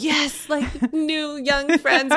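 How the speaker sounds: high, slow, drawn-out eager voice; mock eager. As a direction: stretch it out like an eager new friend. Retelling her eager first meeting.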